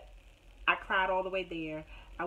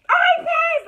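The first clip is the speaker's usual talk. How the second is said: much higher, loud shout of joy; joyful shout; 16 dB louder than usual. Real, not acted. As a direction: scream it with relief and delight. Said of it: "I was like".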